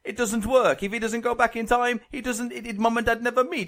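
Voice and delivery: hammed-up Italian accent, earnest arguing; comic Italian-accented complaint. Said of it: "Thirty seconds in Italian".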